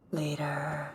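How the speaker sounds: fading away. Shown as a demonstration. Repeats word, fading away.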